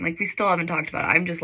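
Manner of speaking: ordinary talk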